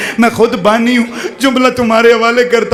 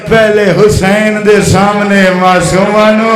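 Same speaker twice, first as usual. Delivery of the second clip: grieving recitation. Acted. Zakir recites grief couplet.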